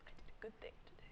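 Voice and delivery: soft proud whisper to self. Pretend. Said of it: "I'm like".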